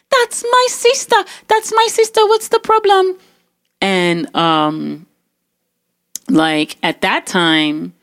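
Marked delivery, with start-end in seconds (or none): 0.1-3.2 very high-pitched frantic voice; mimicked alarmed sister. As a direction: squeal it like a sister rushing in. Mimics sister running downstairs.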